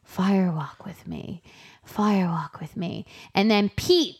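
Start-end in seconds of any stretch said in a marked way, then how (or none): 0.0-3.0 slow unvoiced whisper; slow eerie whispered mantra. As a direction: breathe it to yourself like a chant. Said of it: "starts saying to himself".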